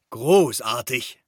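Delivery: put-on slow, drawn-out praise from an old uncle; Uncle Jakob calling. A narrator's reading. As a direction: stretch the word out in admiration. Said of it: "rief Onkel Jakob".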